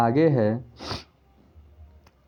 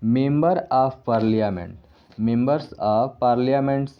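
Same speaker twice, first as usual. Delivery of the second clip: very slow dictation; much slower than usual. Shown as a demonstration. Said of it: "तो इसमें किस में S लगेगा".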